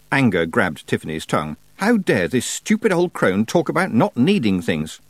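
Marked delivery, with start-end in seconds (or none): none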